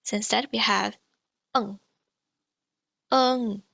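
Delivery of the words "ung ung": ung with the back ng ending; ung sound. Shown as a demonstration. Teacher demonstrates the eng final as ung. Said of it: "like this".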